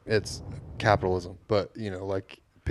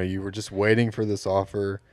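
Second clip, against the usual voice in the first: ordinary talk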